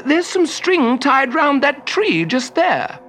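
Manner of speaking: high, slow, coaxing hint; helpful wizard. A narrator's reading. Wizard directs troll to the string, high and helpful. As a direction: point it out with sly innocence. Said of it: "the wizard suggested helpfully".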